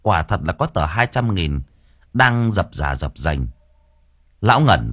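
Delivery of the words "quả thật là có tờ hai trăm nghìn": plain reading voice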